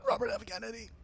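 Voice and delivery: very high raspy impression; Robert F. Kennedy. Comedian naming the politician in his raspy, high voice. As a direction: squeeze the name out in a strained rasp. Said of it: "You gotta get very raspy".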